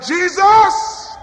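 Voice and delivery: crying out. Retold or imitated. Preacher crying out his own prayer, high plea. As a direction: call his name from the heart. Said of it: "I've cried out".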